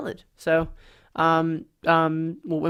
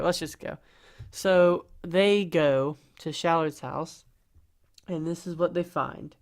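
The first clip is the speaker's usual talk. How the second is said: usual speaking voice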